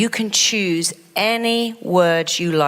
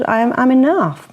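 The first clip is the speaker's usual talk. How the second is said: rising, louder declaration of I'm enough; I'm enough said proudly, lightly acted; firm self-affirmation; 6 semitones higher, 5 dB louder than usual. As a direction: claim it with conviction. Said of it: "they start to tell them".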